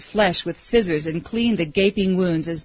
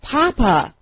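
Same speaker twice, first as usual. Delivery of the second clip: put-on falling boy's raised, higher cry; mild scream; 5 semitones higher, 4 dB louder than usual. A narrator's reading. Falling boy screams for his father, mild scream. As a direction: cry out for a parent, understated scream. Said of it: "He screamed".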